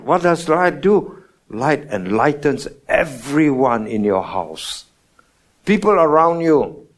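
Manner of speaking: ordinary talk, nothing marked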